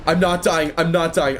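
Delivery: tense, loud repeated plea; panicked. Real, not acted. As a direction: refuse to die, urgent. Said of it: "gaming panic, loud".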